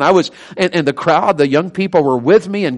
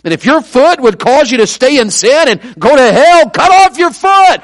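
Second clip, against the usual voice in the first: wound-up preaching; 7 semitones higher, 7 dB louder than usual. Retold or imitated. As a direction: re-enact a preacher building to his command. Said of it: "as I was preaching".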